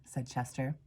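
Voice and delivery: usual speaking voice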